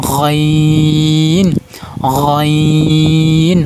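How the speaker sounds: গইন drawn out from the back of the throat, loud and long; like gargling water, a long loud গইন; guttural ghain demo. Shown as a demonstration. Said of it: "গলার শেষ অংশ উচ্চারণ করবেন".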